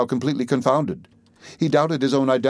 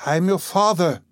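higher, heartfelt cry of recognition; emotional cry; 7 semitones higher than usual. A narrator's reading. Rip revealing himself to his daughter, crying out. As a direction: burst it out to her. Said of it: "cried he".

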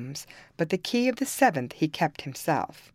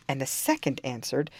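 plain reading voice

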